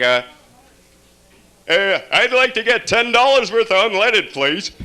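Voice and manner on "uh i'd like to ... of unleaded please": high, stiff actor voice; actor in the scene. Retold or imitated. Actor fumbling his gas station line, high and stiff. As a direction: play a clueless actor reciting his line. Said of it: "He's like".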